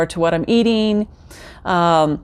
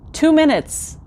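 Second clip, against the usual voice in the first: "Two minutes" jumps much higher in disbelief; incredulous repeat; 7 semitones higher, slower than usual. Shown as a demonstration. Repeating the short treadmill time to viewers, a high incredulous "Two minutes".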